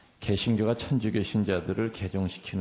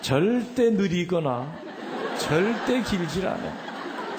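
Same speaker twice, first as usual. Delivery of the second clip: slow, high stretch on "절대"; emphatic, high-pitched insistence with long "절대"; drawn-out emphasis; 9 semitones higher, slower than usual. Real, not acted. Mock emphatic proof to audience.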